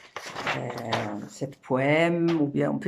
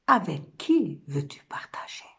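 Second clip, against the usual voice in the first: souffle presque sans voix; question chuchotée. Acted. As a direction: retomber dans le murmure pour interroger. La femme interpelle l'homme qui part. (chuchotement de femme délaissée)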